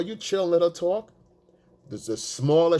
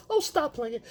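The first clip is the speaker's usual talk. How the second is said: much higher, flattered protest; coy girlfriend; 13 semitones higher than usual. Retold or imitated. Girlfriend teased by her boyfriend, much higher coy protest. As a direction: brush off the teasing, pleased.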